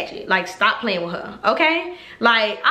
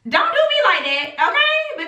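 higher pitched, okay sliding way up; pleading protest; 13 semitones higher than usual. Retold or imitated. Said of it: "And I was like".

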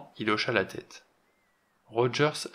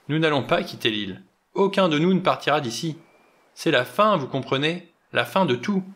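doomed outburst; 5 semitones higher, 5 dB louder than usual. Acted. General's rising doomed outburst.

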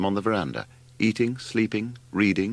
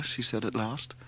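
plain reading voice

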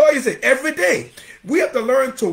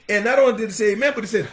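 ordinary delivery

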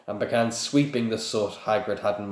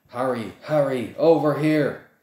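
louder calling, pitch rising; 5 semitones higher, 5 dB louder than usual. Acted. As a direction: call across the street. Hermione calling across street.

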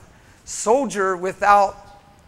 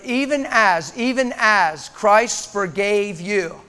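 slow emphasis; slower than usual. Acted. Preacher stretching key phrase.

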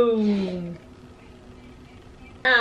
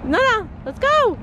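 urgent hurry; 14 semitones higher than usual. Real, not acted. Rushing out, calling to hurry.

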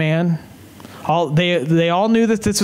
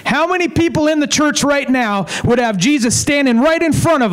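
higher-pitched, driving sermon build; impassioned preaching; 5 semitones higher than usual. Real, not acted. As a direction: preach it with rising insistence.

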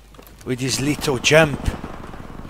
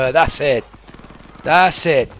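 normal talking voice

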